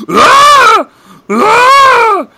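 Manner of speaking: high shrieking scream; burning scream. Retold or imitated. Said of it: "that same shriek he does in Kick-Ass where he's burning alive".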